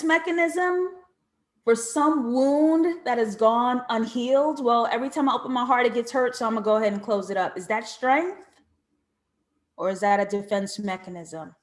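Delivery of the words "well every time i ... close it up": fast mock excuse. Voicing a hurt person's excuse.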